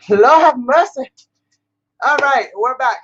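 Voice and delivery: slow, out of breath from the stairs. Real, not acted. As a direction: gasp it out after running. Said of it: "Let me just catch my breath".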